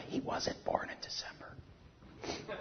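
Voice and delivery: slow whisper, leaning in; a slow confiding whisper to the church; whispered aside. Real, not acted. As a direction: let them in on a secret, whispered.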